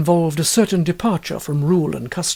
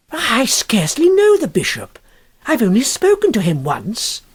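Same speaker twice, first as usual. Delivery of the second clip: flustered exclamation; 6 semitones higher than usual. A narrator's reading. Huddle exclaiming to his sister, flustered and defensive. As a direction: defend yourself in a hurry. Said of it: "exclaimed J P Huddle".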